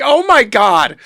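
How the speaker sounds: loud, high mock exclamation; imitated scandalized yell; retold exasperated outburst. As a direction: cry out as the appalled daughter. Retold exasperated reaction of daughter.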